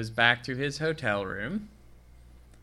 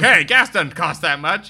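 mock protest; 4 semitones higher, 9 dB louder than usual. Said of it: "He's like".

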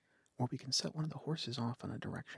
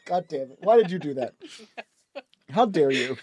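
loud high hammed-up outrage; mock outrage; 7 semitones higher, 14 dB louder than usual. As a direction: cry out in fake betrayal. Mock outrage at horse slap.

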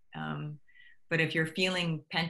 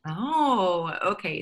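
ordinary delivery

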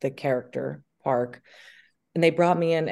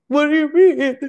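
high, loud, put-on confused voice; confused disbelief; 12 semitones higher, 10 dB louder than usual. Answering the studio's call, loud high confusion. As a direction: squeak it out like you have no idea you're late.

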